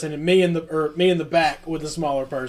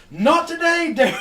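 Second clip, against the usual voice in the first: mock trash talk; 10 semitones higher than usual. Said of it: "Tony goes".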